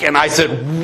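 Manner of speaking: normal talking voice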